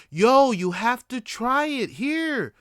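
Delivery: high, loud, drawn-out imitation of his date; mock pestering, stretched out slow and high; pushy urging. Date shoving weed in his face, pushy.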